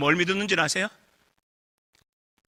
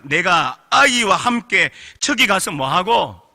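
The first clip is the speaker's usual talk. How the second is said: slow reading; much slower than usual. Shown as a demonstration. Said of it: "그 다음 말 보십시오".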